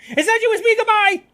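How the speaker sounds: very high, loud squeal; panicked blurt. Acted. Student speeding off from Gallus, panicked blurt. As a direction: blurt it out and bolt. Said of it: "she blurted before speeding off".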